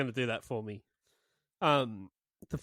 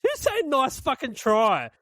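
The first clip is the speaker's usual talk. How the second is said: higher, louder indignant throwing back of a quote; 8 semitones higher, 5 dB louder than usual. Retold or imitated. Host rounds on co-host who faintly praised the Punjabi, indignant. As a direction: throw it back loudly, mock-offended. Mock indignation at co-host's verdict.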